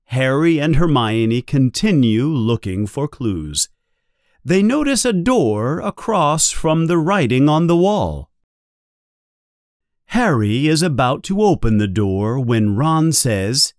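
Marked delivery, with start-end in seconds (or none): none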